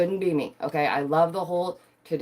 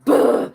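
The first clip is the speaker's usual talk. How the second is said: mock sob burst; 11 dB louder than usual. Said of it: "I'll go home and I'll be like".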